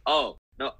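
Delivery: usual speaking voice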